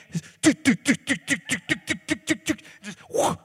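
playful chopping noise effects; imitated chopping sounds. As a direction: rattle off quick do-do-do noises. Imitating the chicken gutting.

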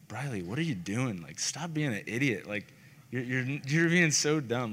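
lightly voiced scolding big brother. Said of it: "kinda that big brother, like, voice".

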